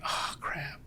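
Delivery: whispered, under his breath; a quick hushed uh-oh; panicked whisper. Retold or imitated. Caught in the wrong formation by the cadre, a whispered curse.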